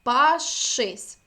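пашись loud, with a long held ш. Shown as a demonstration. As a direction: hold the ш in пашись for the class. (пашись голосно, з подовженим ш)

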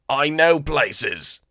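high, very slow eager alien; bargaining alien, drawn out; Weequay voice. Acted.

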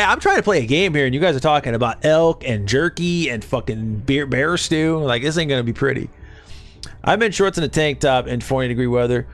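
ordinary talk

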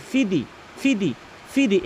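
ফি দি with two short i's; wrong short vowels. Shown as a demonstration. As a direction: cut both vowels of ফি দি short. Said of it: "এভাবে বলে".

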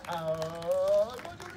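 very slow, stretched out, low start; drawn-out praise. Acted. Host praises the child whose mouth is empty, drawn-out praise. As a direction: stretch the praise right out, gliding upward.

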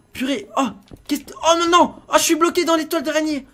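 loud, high cry of frustration; panicked. Pretend. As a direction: yell out in dismay, suddenly stuck. Gets stuck during the chase. (cri de panique, voix aiguë)

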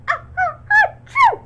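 building sneeze, loud burst on achoo; big sneeze. A narrator's reading. Mom sneezing loudly into the tree. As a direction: wind up and explode the sneeze. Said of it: "Mom nodded gloomily and sneezed".

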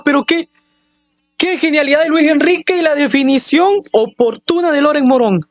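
high, rapid, ecstatic goal shouting; breakneck goal commentary. Real, not acted. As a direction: rattle it off like a delirious commentator. Equaliser goal celebrated live.

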